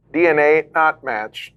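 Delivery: robotic computer voice; flat machine announcement; automated voice. Acted. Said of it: "you hear an automated voice say".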